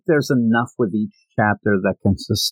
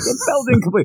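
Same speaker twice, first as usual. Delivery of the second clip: high-pitched mock complainer; mock complaint; 12 semitones higher than usual. Host voicing reviewers' verdict on the first chapter, mock complaint. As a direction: put on a high voice for the griping listener. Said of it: "people are gonna yell at us".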